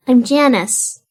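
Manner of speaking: put-on a shy little kid introducing himself. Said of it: "you hear a little voice that says".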